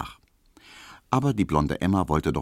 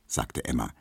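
plain reading voice

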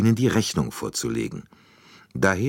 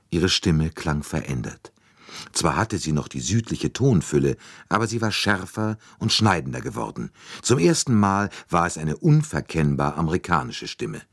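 plain reading voice

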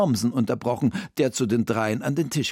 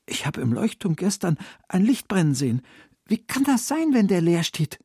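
put-on puzzled boy, pitched higher; Emil als Figur; 8 semitones higher than usual. A narrator's reading. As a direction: voice a young boy wondering at a mystery. (erhöhte, verwunderte Jungenstimme)

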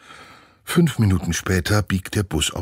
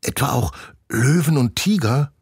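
put-on high, awed girl's question; wide-eyed wonder; 9 semitones higher, 3 dB louder than usual. Nina asking Olaf about the lions, amazed. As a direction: gasp it out with big eyes. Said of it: "Nina bekommt große Augen".

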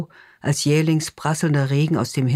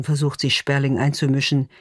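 ordinary delivery, nothing marked

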